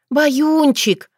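high, drawn pet name; affectionate call. A narrator's reading. Girl kneeling to hug her cat, affectionate. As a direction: croon the name fondly.

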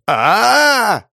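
long high scream of pain; drawn-out agonised "aah"; pained wail. Acted. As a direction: cry out loudly as fingers twist. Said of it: "вопль".

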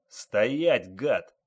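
loud, angry police order; shouted command. Acted. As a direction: order a suspect to freeze, loudly. Police officer orders suspect to halt.

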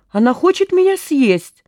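high-pitched alarmed complaint; squeal. A narrator's reading. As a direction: squeal it, without full volume. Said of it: "визг Гуркина". (испуганно взвизгивает)

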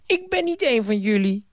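put-on very high, fast indignant boy; childish Bram. Bram brushing off the ghost, very high childish voice. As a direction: squeak it back like a defiant kid. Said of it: "zei Bram".

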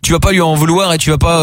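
normal talking voice

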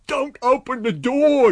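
high, loud, slow scream. A narrator's reading. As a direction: scream it in terror. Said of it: "he screamed".

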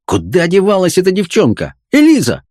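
high-pitched, cross summons, climbing at the end; angry calling. A narrator's reading. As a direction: yell for a girl who won't come. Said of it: "закричал он сердито".

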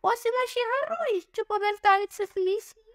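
squealing villainess, jumping high; shrill squeal. A narrator's reading. Oblivia squealing from behind the Egyptian, a hint of villainy. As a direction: shriek it out from behind him.

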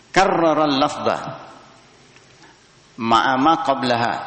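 measured reading of the Arabic text; formal kitab reading; text recitation. Acted. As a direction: recite the line from the book.

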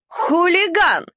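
high, slow, drawn-out yelp; shrill barking. A narrator's reading. Dachshund Nelli yelping at the puppy, high and drawn out. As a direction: bark it shrilly like a prim dachshund. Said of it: "визгливо пролаяла Нелли".

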